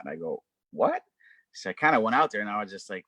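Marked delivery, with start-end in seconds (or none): 0.7-1.0 sudden high surprised yelp of a question; surprised what. Retold or imitated. Teen hearing of DJs outside, surprised high what. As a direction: jolt up in disbelief.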